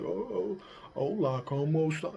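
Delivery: put-on flat, clumsy gringo Spanish accent; mock a learner's stiff gringo Spanish accent; bad gringo Spanish accent. Mocking a learner with a clumsy gringo Spanish accent. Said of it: "so you don't sound like".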